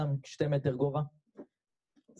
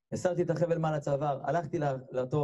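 ordinary delivery, nothing marked

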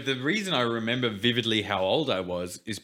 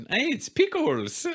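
much higher and slow, a cute little dog voice; voicing the pet dog; slower than usual. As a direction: speak as the dog introducing himself.

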